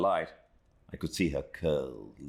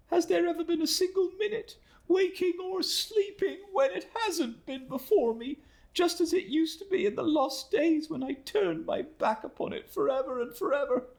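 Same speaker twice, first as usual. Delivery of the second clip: put-on much higher, pleading young woman's voice; pleading exclamation. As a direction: beg for mercy in a high, fragile young woman's voice. Emily pleads on her knees.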